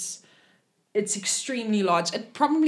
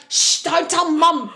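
high, slow hushing plea; urgent shushing; 7 semitones higher, slower than usual. Acted. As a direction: shush someone and plead for secrecy. Hushing brother about telling their mother.

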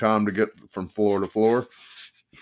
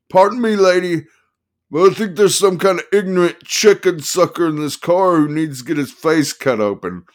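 high, loud manic lawyer; excited manic attorney; 9 semitones higher, 7 dB louder than usual. Acted. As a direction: play the wild attorney, pitched up and loud. Attorney voiced, menacing.

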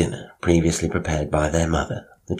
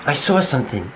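high, loud scared cry; frightened cry; 10 semitones higher, 4 dB louder than usual. A narrator's reading. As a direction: cry out in alarm. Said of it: "Bill cried".

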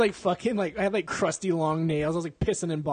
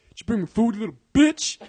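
higher, pushed, hammed-up bossiness; mock bullying demand; 5 semitones higher, 5 dB louder than usual. Boyfriend greeting girlfriend coming home, mock bullying. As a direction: play the demanding boyfriend, half-joking. Acts out bossy boyfriend yelling.

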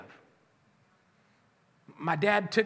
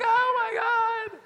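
horrified tourist gasp; 13 semitones higher, 5 dB louder, much slower than usual. Retold or imitated. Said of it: "They're crying".

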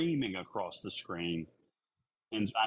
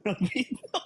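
much higher, louder incredulous question; amused disbelief; 13 semitones higher, 4 dB louder, slower than usual. Real, not acted. Co-host hearing about the pee pill, amused disbelief. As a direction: echo it back in amused disbelief.

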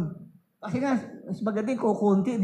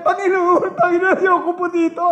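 high, loud frantic begging; desperate plea; 8 semitones higher, 5 dB louder than usual. Retold or imitated. Brother trapped in hell begs the Lord, frantic. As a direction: cry out to be taken out of hell. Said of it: "Sabi raw niya".

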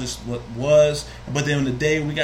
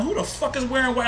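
incredulous; 10 semitones higher than usual. Retold or imitated. Shocked thought at funeral.

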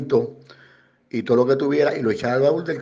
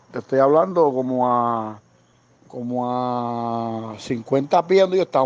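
usual speaking voice

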